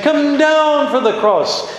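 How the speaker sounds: high, slow, laughing jeer; mocking taunt. Scribes taunting Jesus on the cross, a high laughing jeer. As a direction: jeer at him from the crowd. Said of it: "The scribes and Pharisees saying".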